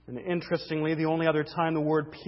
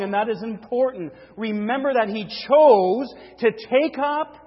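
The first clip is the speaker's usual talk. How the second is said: raised, emphatic preacher's delivery; forceful preaching; 7 semitones higher, 9 dB louder than usual. Real, not acted. Preacher to congregation on Christ bearing sins, forceful preaching. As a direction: preach it with force, weighting each phrase.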